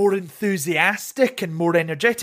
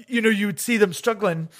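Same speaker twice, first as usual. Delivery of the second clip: ordinary delivery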